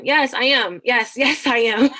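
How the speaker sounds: cheerfully owning up to preaching; playful preaching yes. Retold or imitated. Teacher laughing as her kids ask if she'll preach. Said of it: "are you about to preach again".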